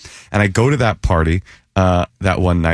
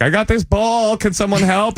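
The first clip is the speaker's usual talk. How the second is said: high, small voice asking for help; high pleading voice; 12 semitones higher than usual. Retold or imitated. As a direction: plead like a lost kid. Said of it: "I said".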